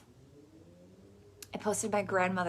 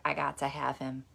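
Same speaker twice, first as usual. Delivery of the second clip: lower, determined grandma; grandma's longing; 4 semitones lower, 4 dB quieter than usual. Retold or imitated. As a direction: say it low with sudden desire. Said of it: "she was like".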